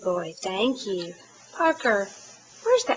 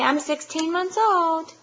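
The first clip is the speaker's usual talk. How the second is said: put-on prompting a toddler; 7 semitones higher, 4 dB louder than usual. Shown as a demonstration.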